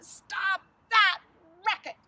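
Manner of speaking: high, loud scolding; bossy yell. A narrator's reading. Sister scolds the noisy bird, yelling bossily. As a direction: yell the order, hitting each word.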